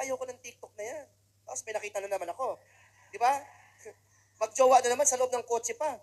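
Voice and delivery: normal talking voice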